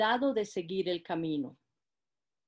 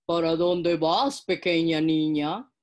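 slow, louder, low sly wolf; 4 semitones lower, 6 dB louder, slower than usual. Acted. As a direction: draw it out like a cunning wolf. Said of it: "preguntó el lobo".

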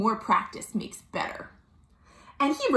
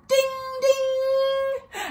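high ringing ding-ding; bright-pinging bell noise, last ding held; bicycle bell. Acted. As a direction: imitate a bike bell, high-pitched. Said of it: "Duck rang his bell".

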